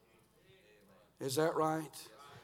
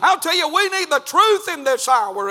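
loud, high, climbing sermon; rising revival preaching; full-force preaching; 8 semitones higher, 11 dB louder than usual. Acted. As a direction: preach it hard to the young people.

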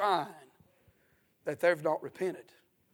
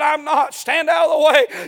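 defiant shout; 8 semitones higher, 15 dB louder than usual. Retold or imitated. Preacher voices Caleb shouting.

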